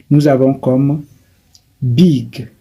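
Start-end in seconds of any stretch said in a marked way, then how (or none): 1.8-2.5 "big" said as an English word, short I. Shown as a demonstration. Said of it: "dans big, nous avons seulement I". ("big" prononcé à l'anglaise, I bref)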